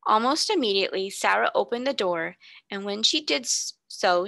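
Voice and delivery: plain reading voice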